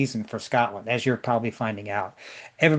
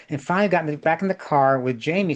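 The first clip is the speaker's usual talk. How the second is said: ordinary delivery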